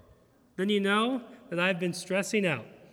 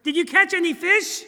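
bright eager question; 9 semitones higher, 7 dB louder than usual. Retold or imitated. Jesus asking, high and loud.

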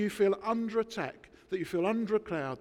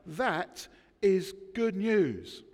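normal talking voice

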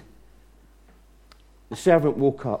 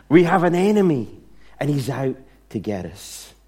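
usual speaking voice

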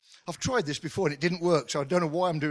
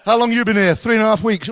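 mock chat; 6 semitones higher, 12 dB louder than usual. Acted exchange between shoppers.

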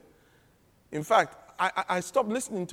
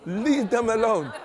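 normal talking voice

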